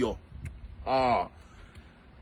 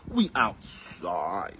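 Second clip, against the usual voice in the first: low slow drawl; 14 semitones lower, 9 dB quieter, much slower than usual. Pretend. Drops to low slow repeat.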